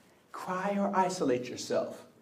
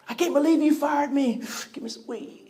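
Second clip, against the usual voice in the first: upset fired worker; 10 semitones higher, 3 dB louder than usual. Acted. Fired person with a full bucket reacts, upset.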